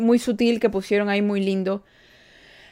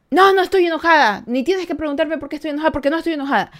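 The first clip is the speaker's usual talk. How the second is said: higher, louder mock of a mother snapping no; mother's angry denial; 5 semitones higher, 4 dB louder, much faster than usual. Mother snapping at her five-year-old, irritated and loud. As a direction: deny it sharply, irritated and defensive.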